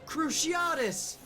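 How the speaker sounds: hammed-up spell scream. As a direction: scream the curse up high. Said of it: "screamed Harry".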